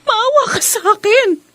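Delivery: high, crying plea for mercy; tearful pleading. Acted. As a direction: beg through tears to be let go. Captive begging to be released.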